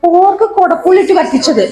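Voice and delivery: high, loud and laughing; excited, laughing. Real, not acted. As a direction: gush over the dish. Excited dish naming with laugh.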